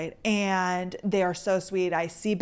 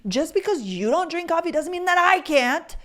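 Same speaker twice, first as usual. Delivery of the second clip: sassy retort; 7 semitones higher than usual. Retold or imitated. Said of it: "the girl gave me attitude".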